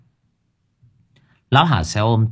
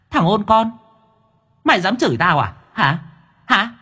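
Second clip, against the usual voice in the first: angry ghost woman; 10 semitones higher than usual. Acted. Female ghost snapping at the drunk who cursed her, high and angry. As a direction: snarl like an offended spirit.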